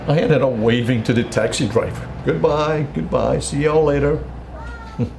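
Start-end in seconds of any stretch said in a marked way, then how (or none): 2.2-4.2 higher-pitched, singsong farewell; retold wave-off, pitched up; calling goodbye. Father waving to the departing taxi, higher retold goodbye call.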